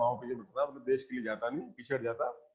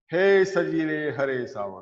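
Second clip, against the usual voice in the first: poem recital; 2 semitones higher, 4 dB louder, slower than usual. Shown as a demonstration. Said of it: "teacher recites the poem line".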